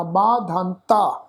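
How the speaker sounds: "ਮਾਧਾਂਤਾ" slowly as maan-dhaa-taa, long nasal vowel; demonstrated pronunciation. Shown as a demonstration. Teacher sounding a word just spelt, slow careful pronunciation. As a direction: stretch every aa in "ਮਾਧਾਂਤਾ". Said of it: "ਉੱਪਰ ਬਿੰਦੀ ਲੱਗੀ ਹੈ".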